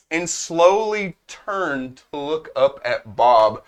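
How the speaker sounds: ordinary talk, nothing marked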